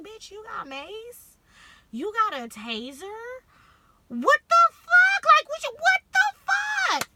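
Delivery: high, drawn-out disbelief rising to a shriek; incredulous shriek. Retold or imitated. Reacting to her cousin having mace and a taser, incredulous shriek. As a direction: build from stunned questions to a screeched outburst.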